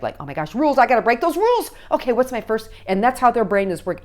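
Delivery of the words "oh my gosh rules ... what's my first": loud rebellious outburst, rising in pitch; mock teenage defiance; angry, mad teen. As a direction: act out a teen itching to break rules. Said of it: "they're instantly going to be angry and mad".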